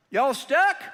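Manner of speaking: loud, high country drawl greeting; a backwoods trucker calling out in a thick country accent. Retold or imitated. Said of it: "Got a thick country accent".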